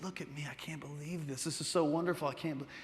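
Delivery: soft, hushed wonder; quietly awestruck; amazed disbelief. Acted. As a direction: marvel at yourself under your breath. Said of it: "she couldn't believe the transformation".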